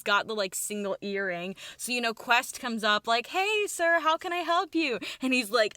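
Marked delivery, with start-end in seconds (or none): 3.3-5.0 high, singsong customer greeting; chirpy helper. Retold or imitated. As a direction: greet a stranger in a much higher voice. Quest greets a library visitor.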